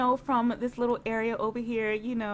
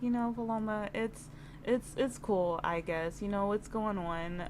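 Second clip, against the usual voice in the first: lower, softer, awkward brush-off; lightly voiced bored guy; 3 semitones lower, 7 dB quieter than usual. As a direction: voice him uninterested and low. Voicing Marcus, uninterested.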